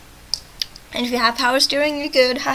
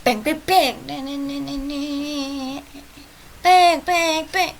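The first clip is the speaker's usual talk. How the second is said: sing-song mock shootout; vocal shots with a "na-na" run between; playful gunfire noises; 3 semitones higher, much slower than usual. Imitates gunfire while skimming text.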